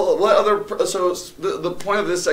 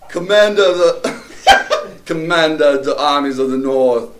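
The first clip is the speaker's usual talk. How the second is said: put-on a shaky Jamaican accent, slow and louder, breaking into laughter; bad Jamaican accent; 3 semitones higher, 6 dB louder, slower than usual. Friends dare him to do Gladiator, badly faked Jamaican accent. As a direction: push a Jamaican accent through the Gladiator speech, giggling. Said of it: "That's all he's got is Jamaican".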